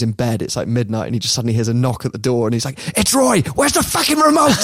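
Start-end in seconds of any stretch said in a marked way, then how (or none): 3.0-4.6 mock furious shout. Roy bangs on Rooney's door.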